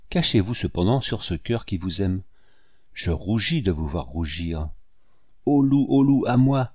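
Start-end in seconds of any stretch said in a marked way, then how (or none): 5.4-6.7 high, loud call for help; shepherd boy crying out. A narrator's reading. Shepherd boy calls the other shepherds, loud false alarm. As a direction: shout it as a boy faking alarm. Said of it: "criait un jeune pâtre".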